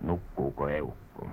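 very soft, low undertone; hushed. Acted. Checking whether the old woman sleeps, hushed. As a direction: keep it down, barely audible.

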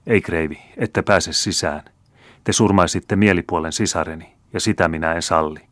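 usual speaking voice

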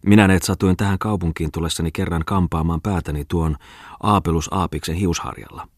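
usual speaking voice

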